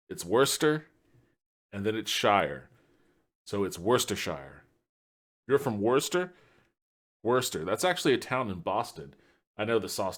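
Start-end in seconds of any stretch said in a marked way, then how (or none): 0.1-4.6 Worcestershire broken up: wuss-ter, then shire; syllable by syllable. Shown as a demonstration. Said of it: "Let me tell you how it's pronounced".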